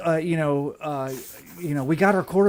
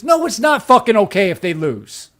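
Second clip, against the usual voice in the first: angry rant; 6 semitones higher, 7 dB louder than usual. Real, not acted. Angry rebuttal to fans.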